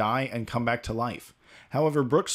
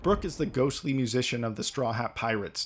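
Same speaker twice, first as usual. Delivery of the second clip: ordinary delivery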